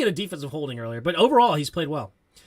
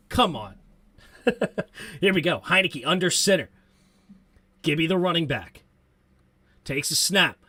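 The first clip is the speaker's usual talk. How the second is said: normal talking voice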